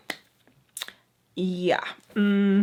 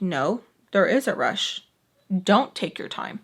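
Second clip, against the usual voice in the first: firm contradiction. Retold or imitated. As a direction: imitate someone overruling her mom, pitch kept down. Sam curtly contradicts her mom.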